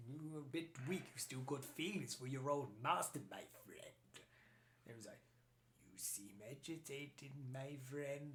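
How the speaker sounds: soft, hushed imitation of the old Emperor; lightly voiced Palpatine. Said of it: "he's like".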